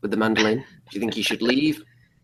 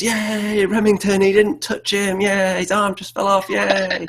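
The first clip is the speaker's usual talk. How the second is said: cheering crowd; 10 semitones higher, 4 dB louder, slower than usual. Acted. Townsfolk cheering for Remington.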